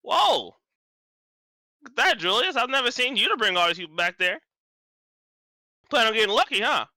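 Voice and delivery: high, loud, teasing character voice; boisterous Goliath. Acted. Goliath ribbing Julius about his guests, boisterous. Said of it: "the Goliath goes".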